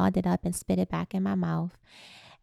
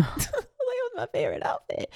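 put-on much higher, sulky child voice; whiny little girl. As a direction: whine it like an upset kid. Child upset over cut clothes.

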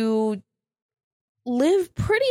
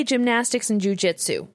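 ordinary talk

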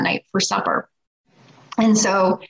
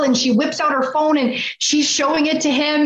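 ordinary talk, nothing marked

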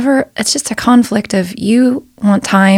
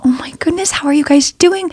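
gushing; 8 semitones higher than usual. Retold or imitated. Gushing greeting to passengers.

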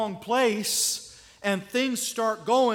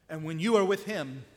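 ordinary delivery

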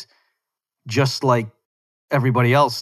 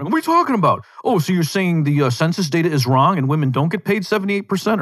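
high-pitched imitation of outraged objectors; mock flipping out; 6 semitones higher than usual. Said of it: "some people were flipping out".

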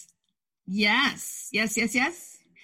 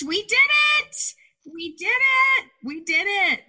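much higher, louder gleeful chant that winds down; excited cheer; 11 semitones higher, 4 dB louder than usual. Real, not acted. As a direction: cheer like you just crossed the finish line. Celebrating finishing the episode.

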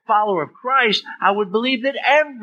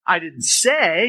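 "say" stretched out and emphasised; slow down and lean on "say"; stress on SAY; 2 semitones higher, much slower than usual. Shown as a demonstration. Teacher showing implied versus spoken, stress on "say". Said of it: "by emphasizing each of those".

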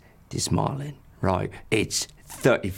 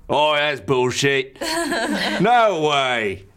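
put-on scoffing drunk; 7 semitones higher, 4 dB louder, slower than usual. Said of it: "the other guy's like".

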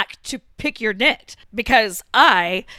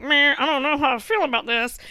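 a lightly voiced doubtful meh; 4 semitones higher, faster than usual. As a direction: shrug it off, unconvinced. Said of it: "and I was like".

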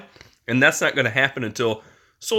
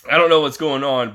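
ordinary talk

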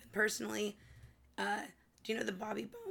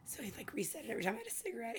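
unvoiced whisper; barely voiced, saving her voice; hoarse whisper; 4 dB quieter, much faster than usual. Real, not acted. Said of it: "no talking".

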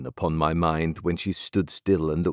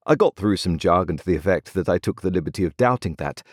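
plain reading voice

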